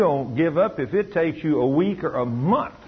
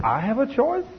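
astonished question; 11 semitones higher than usual. Retold or imitated. Emily voiced astonished.

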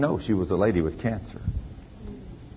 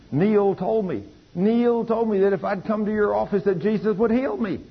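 excited screaming; 11 semitones higher than usual. Retold or imitated. Healed woman bursting into his office, high excited cry. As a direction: burst in crying out the news. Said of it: "she comes screaming into my office".

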